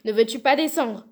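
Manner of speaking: loud, impatient question; shouted demand. A narrator's reading. Bluebeard demands that his wife come down, shouted demand. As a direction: shout it up as an order. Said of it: "criait la Barbe Bleue".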